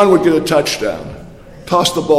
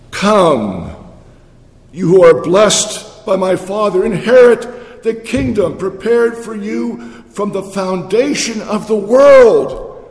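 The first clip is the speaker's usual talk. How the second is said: preaching; 3 semitones higher, 4 dB louder, slower than usual. Acted. Preaching the Father's welcome.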